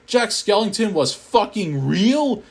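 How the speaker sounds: plain reading voice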